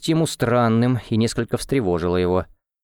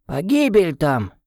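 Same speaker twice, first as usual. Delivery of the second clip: put-on high, wheezy old villager warning; a shuffling old man scolding, pitched high; breathless old person; 8 semitones higher than usual. Old man warns Tim away.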